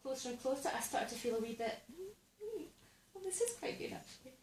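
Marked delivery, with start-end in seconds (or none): 1.9-4.3 high, soft little voice; tentatively won over. Acted. Comic acting her surprise as dolphins swim closer, tentatively pleased. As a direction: think it aloud, softly and much higher.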